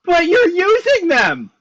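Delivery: high, loud, slow demand; alarmed shout. Acted. Character confronts mysterious fortune teller.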